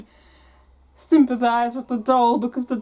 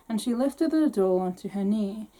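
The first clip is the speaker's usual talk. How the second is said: plain reading voice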